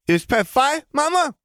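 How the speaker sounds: put-on high, loud little boy voice; small child voice. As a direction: babble like an excited toddler. Child Ben asks mother.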